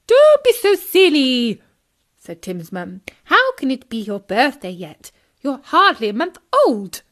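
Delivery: loud scolding voice; stern mother. Acted. Frog mother scolding her son. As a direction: cut him off firmly.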